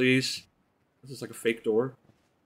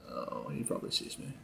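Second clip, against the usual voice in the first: whisper; 12 semitones lower, 10 dB quieter than usual. Real, not acted. Hiding from enemy, hushed.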